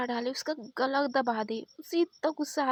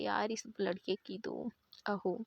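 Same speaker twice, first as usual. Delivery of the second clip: slow whispered aside; 4 semitones lower, 6 dB quieter than usual. Acted. Coworker whispers about the fuming waiter, in a slow whisper. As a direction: whisper it behind his back.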